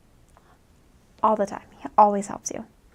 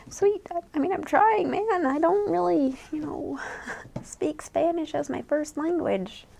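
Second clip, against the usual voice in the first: exasperated reply; 5 semitones higher, 3 dB quieter than usual. Retold or imitated. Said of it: "And I was like".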